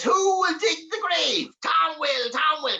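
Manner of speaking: very high, drawn-out demon call; high excited goblin call; awful excited. Acted. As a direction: chant it in giddy imp glee. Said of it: "awful excited".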